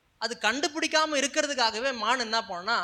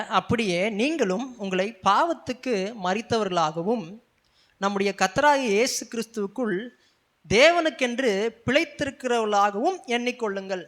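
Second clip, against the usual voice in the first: loud, measured verse reading with punched key words; emphatic scripture reading; 6 dB louder than usual. Real, not acted. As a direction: declaim the verse to the congregation. Reads the Bible verse aloud. (உரத்த குரலில் அழுத்தமாக வசனம் வாசித்தல்)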